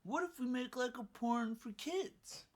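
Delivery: lightly voiced clueless idiot; mock idiot voice. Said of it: "some idiot, will say".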